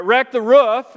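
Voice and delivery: ROOF with the short oo of "book"; short vowel roof. Shown as a demonstration. Pastor insisting on his own way, short-vowel ROOF. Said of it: "is what I would say".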